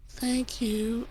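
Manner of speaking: high, very soft and timid; meek thank you. Retold or imitated. Wood meekly thanking the First, high and very soft. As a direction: barely breathe it out, high and small.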